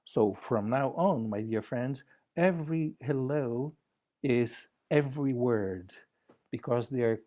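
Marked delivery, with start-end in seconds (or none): none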